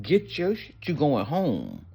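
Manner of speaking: cellmate's eager command, high then drawn out; excited urging. Retold or imitated. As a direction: push her to move, grinning. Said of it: "They're like".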